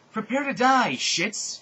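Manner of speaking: high, loud villain yell; screamed threat. A narrator's reading. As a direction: shout a hammed-up battle threat. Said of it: "she screamed".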